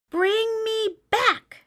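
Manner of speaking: high, slow desperate call; shouting. A narrator's reading. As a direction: cry out from nowhere like a lost mouse. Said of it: "shouted the mouse".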